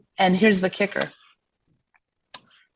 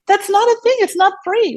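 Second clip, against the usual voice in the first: much higher and louder, incredulous squawk; outraged protest; 4 dB louder than usual. Retold or imitated. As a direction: blow up in disbelief at the scheduler. Acting out her outraged protest.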